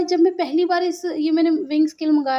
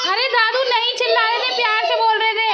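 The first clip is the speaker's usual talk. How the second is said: calling out; 9 semitones higher than usual. Real, not acted. Calling to family across house.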